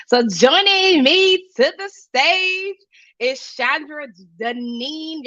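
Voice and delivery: slow, drawn-out stage announcement; announcer intro. Acted. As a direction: announce her like a show host, high and slow. Host announcing guest to the stage.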